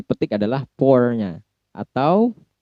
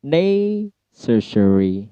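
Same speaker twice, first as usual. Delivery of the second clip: slow classroom demo of Necessary; stress on NE; 2 semitones higher, much slower than usual. Shown as a demonstration. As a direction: draw out "Necessary" and lift the first syllable. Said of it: "Kalau necessary berarti".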